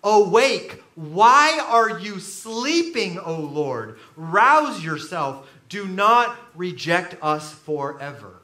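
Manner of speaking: psalm reading. Shown as a demonstration. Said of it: "Hear what the psalmist says".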